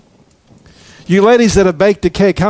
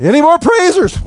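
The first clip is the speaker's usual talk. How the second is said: much higher, mock commander calling for more praisers; a leader shouting down the line for more praisers, half-joking; Joshua calling out; 12 semitones higher than usual. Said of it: "Joshua's gonna say".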